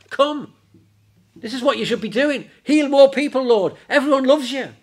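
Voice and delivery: high, loud and insistent; indignant urging. Acted. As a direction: press him hard, voice pitched right up. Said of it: "being really a bit indignant and upset".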